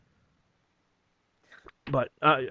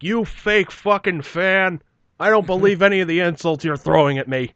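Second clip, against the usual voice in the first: slow, high, loud theatrical wounded fan; melodramatic mock fan; 6 semitones higher, 6 dB louder than usual. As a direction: ham it up like a soap-opera betrayal. Imagined melodramatic heckled fan.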